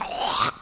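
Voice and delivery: imitated hawk and spit; noisy mock throat-clearing spit; spitting noise. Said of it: "he goes".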